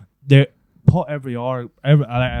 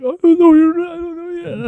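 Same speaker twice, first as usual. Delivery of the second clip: mock scared kid. Lad imitating scared first years, high squeaky voice.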